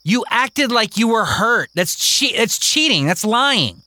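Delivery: put-on high, whiny protest of a boy; indignant kid. Kingston protesting to Mary, high indignant whine. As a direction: complain that it's not fair.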